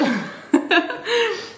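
bright giggle that rises high; high laugh. Real, not acted. Laughing at too much candy.

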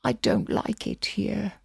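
put-on high, soft little-girl voice; small voice. A narrator's reading. Alice tells Will her unease in a small voice. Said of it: "still using her small voice".

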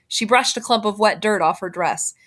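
usual speaking voice

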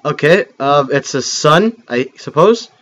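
usual speaking voice

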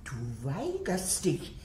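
ordinary talk

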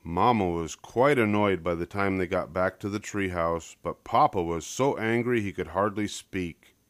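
plain reading voice

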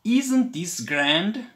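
slow, high, loud marvelling; delighted. A narrator's reading. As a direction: savour the wonder of the big house. Mice exploring grand house.

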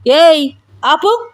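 sharp, high, drawn-out yell; loud, slow command to stop; loud shout. A narrator's reading. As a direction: shout to cut her off. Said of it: "గట్టిగా అరిచాడు".

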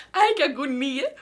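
high shrieking laugh into a slow, loud groan; laughing agony. Real, not acted. Daughter laughs nervously as her mom calls her. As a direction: laugh out the nerves. Mom calling during prank.

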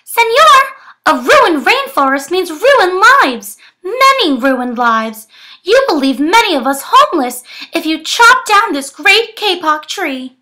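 high piping tree frog; squeaky voice. Acted. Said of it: "In a squeaky voice".

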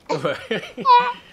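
big squealing laugh; high laugh. Real, not acted. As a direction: burst out laughing. Laughing after pirate practice.

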